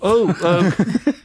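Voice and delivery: high, flustered hesitation; nervous crew kid. Acted. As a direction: caught off guard. Kid stammers, pressed by officer.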